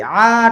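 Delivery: আ dragged out as the mistake to avoid; wrongly stretched. Shown as a demonstration. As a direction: stretch the vowel of আ too long. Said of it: "টানা যাবে না".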